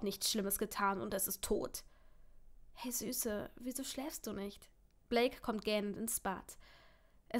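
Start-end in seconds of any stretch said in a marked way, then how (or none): 2.7-4.7 soft, tender murmur; hushed, loving late-night question; gentle concern. Acted. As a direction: ask your sweetheart softly and with care. Partner gently asks at night.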